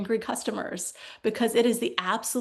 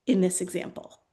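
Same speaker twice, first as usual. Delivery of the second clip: ordinary talk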